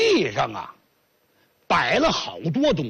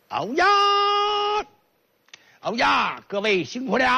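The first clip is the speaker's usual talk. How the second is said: put-on southern accent; 5 semitones higher than usual. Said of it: "一张嘴，南方人".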